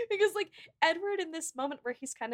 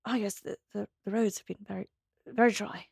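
Hugh Grant; 3 semitones lower than usual. Retold or imitated. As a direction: stammer it gently, like an awkward gentleman. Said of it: "I gotta lean in like I'm an A S M R person".

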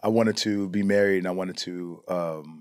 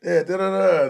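mock casual chat; 8 semitones higher, slower than usual. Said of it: "talking regularly".

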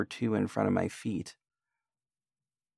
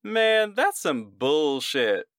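high, drawn-out jeering yell; yelled heckle; 7 semitones higher, slower than usual. Acted. Someone in the crowd yells after the ghost tale, heckling yell. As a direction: call it out slowly, pitched well up. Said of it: "until finally someone yelled".

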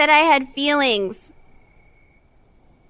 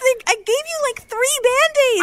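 flustered protest; 12 semitones higher than usual. Acted.

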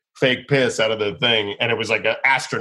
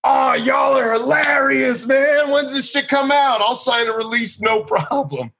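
delighted shout; 13 semitones higher than usual. Retold or imitated. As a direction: whoop like this is the best news ever. Barber suddenly delighted.

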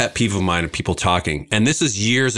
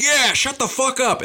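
mock cheering bystander. Imagined cheering onlookers.

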